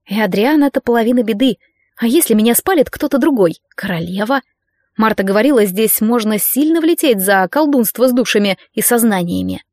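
plain reading voice

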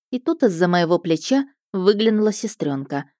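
ordinary delivery

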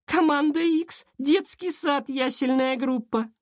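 high, slow, mocking girl's voice; chant-like jeer, high and drawn out; jeering schoolgirl. A narrator's reading. Schoolgirl jeers at rivals. (ехидная девчонка, высоко и протяжно)

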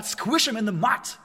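mock battle cry. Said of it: "die Parole lautet wieder mal".

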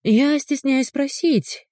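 high, slow mock-polite question; snide. A narrator's reading. Ulyana to the fence-climbing stranger, snide. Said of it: "ехидно начала Ульяна".

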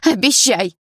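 loud insistent demand; stubborn demand. A narrator's reading. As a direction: insist on it, loudly. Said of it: "упрямо сказала я". (упрямо и громко)

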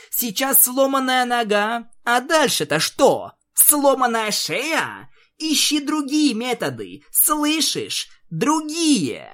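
high, fierce raging; enraged. Acted. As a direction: build to fury. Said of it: "Твайлайт озверела". (в бешенстве)

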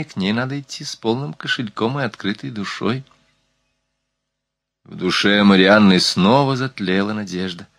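usual speaking voice

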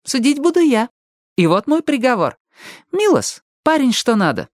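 high-pitched teasing verdict; snide. A narrator's reading. Ali passes mock judgement on her companion, snidely. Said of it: "ехидно заявила Али".

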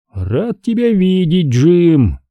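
голос брата повыше, слова врастяжку; слегка сыгранная радость встречи, высоко и медленно; Шейн. A narrator's reading. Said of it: "продолжил Шейн". (чуть приподнятый, протяжный голос брата)

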